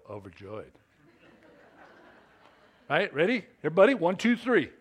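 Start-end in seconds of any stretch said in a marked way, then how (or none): 0.1-0.7 quiet, dull, no joy at all; unenthusiastic. Shown as a demonstration. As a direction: say it like it means nothing. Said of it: "You don't just go".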